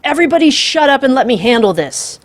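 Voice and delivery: high, loud furious command; yelling. A narrator's reading. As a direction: shout everyone down. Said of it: "Bailey yelled".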